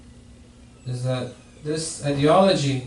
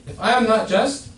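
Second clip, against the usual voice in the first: a raised, indignant retort, higher and louder; retold anger; 7 semitones higher, 4 dB louder than usual. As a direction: answer the insult with restrained anger. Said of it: "The Prophet, he got angry".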